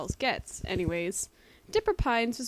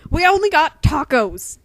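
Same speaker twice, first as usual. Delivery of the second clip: higher, louder sudden interruption; cutting in loud; 6 semitones higher, 14 dB louder than usual. A narrator's reading. Old cashier loudly cutting off Dipper. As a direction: cut him off loudly. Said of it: "the cashier interrupted".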